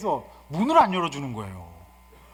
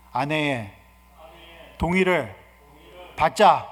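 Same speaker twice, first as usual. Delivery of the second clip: call to repeat; 6 dB louder, slower than usual. Shown as a demonstration. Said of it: "따라서 해봅시다".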